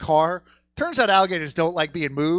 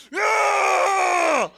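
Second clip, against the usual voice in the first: long high shout; cheering whoop; 3 dB louder than usual. Retold or imitated. Whooping at the free alligator plan. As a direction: whoop with joy.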